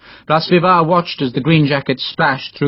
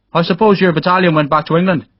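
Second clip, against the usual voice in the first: put-on Irish accent; 6 semitones higher than usual. A narrator's reading. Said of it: "was spoken in an Irish accent".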